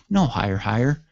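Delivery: ordinary talk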